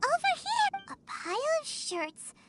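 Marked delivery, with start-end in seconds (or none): 0.0-0.8 higher, lively call-out. Acted. Child investigator calls companion over to a find, calling out.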